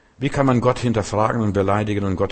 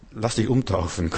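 ordinary talk

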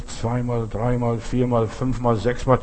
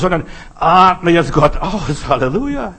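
slow fervent preaching; 4 semitones higher, 5 dB louder than usual. Acted. Preaching climax.

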